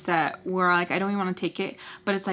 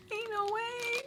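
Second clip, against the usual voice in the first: much higher, softer squeaked Ain't no way; a high, squeaky comic repeat of Ain't no way; squeaky disbelief; 14 semitones higher, 8 dB quieter, slower than usual. Acted. Squeaky repeat after laughing.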